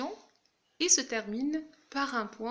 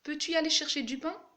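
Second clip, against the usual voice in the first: higher, quick question rising to the end; question intonation; 5 semitones higher, faster than usual. Shown as a demonstration. Teacher models the inverted question for the class, rising tune. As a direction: lift the voice on "aller" and keep climbing to "pain". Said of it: "l'inversion sujet-verbe".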